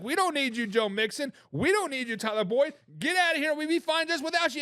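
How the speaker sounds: mock dismissive. Host mimics team dropping its players, mock dismissive. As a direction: wave them away, pitch climbing toward the end.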